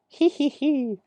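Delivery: very high, impish cartoon giggle; Luffy giggle. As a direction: giggle like a mischievous boy hero.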